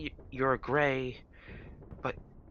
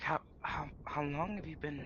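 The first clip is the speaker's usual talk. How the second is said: much softer, near whispered question; curious villager; 2 semitones higher, 8 dB quieter than usual. Acted. Villager quietly asking the newcomers how long they've been here. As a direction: ask it hushed and tentative. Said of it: "it's like".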